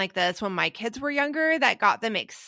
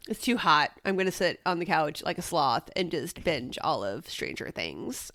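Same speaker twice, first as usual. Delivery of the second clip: lower, lazy couch-sloth voice; a sluggish, lazy mock self on the couch; 5 semitones lower, 4 dB quieter than usual. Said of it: "And then I'm like".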